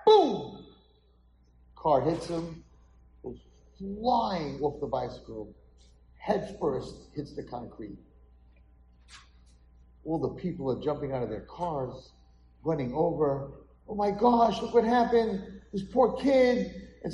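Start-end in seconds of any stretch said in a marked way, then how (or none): 0.1-0.6 loud, high shouted boom; sudden crash boom. Retold or imitated. Imitated crash heard by boy. 13.9-16.7 high-pitched shocked bystander; alarmed onlooker. Acted. Onlookers react to the accident.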